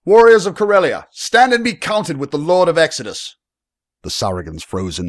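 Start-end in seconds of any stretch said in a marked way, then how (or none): 0.0-3.4 very high, loud battle call; full-throated commanding lord's bellow; bellowed rallying cry. A narrator's reading. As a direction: bellow to rally your warriors. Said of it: "Arius bellowed".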